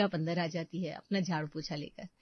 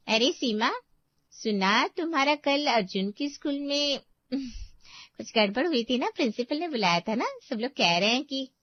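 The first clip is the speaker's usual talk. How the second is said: nosy neighbour; 6 semitones higher, 3 dB louder than usual. Acted. Gossipy neighbour drops by.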